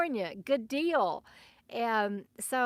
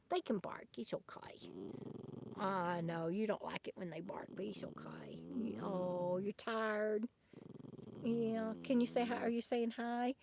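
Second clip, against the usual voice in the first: hushed, much softer reassurance, partly whispered; calm the dog down gently; soft whispered comfort for a nervous pet; soothing the dog; 2 semitones lower, 11 dB quieter than usual. Real, not acted. Soothing her dog softly.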